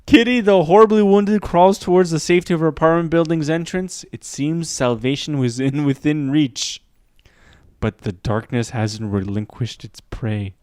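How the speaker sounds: suspenseful narrator, hope fading to menace; dramatic true-crime narration. Acted. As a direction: wind down into ominous storytelling. Reads crime story dramatically.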